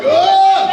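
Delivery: sudden high loud "Ugh!" groan; hammed-up pleasure groan; mock orgasm groan. Comic mimicking a woman's sex noise, hammed-up groan. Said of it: "that is Bridget's sex sound noise".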